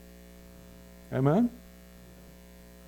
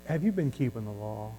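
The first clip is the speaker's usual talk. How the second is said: softer, understated mild question; soft-sell preacher; 3 semitones lower, 7 dB quieter than usual. Acted. Somebody down the road asking, mildly and softly. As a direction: ease into it gently. Acting out a gentler false preacher.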